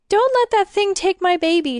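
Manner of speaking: high, loud, desperate plea; panicked sobbing cry. A narrator's reading. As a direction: cry it out in frantic terror. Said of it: "she sobbed".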